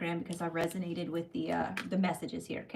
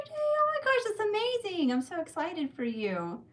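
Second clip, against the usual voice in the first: much higher, louder delight; thrilled squeal; 13 semitones higher, 3 dB louder than usual. Real, not acted. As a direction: squeal with joy for a viewer. Viewer's prayer came true.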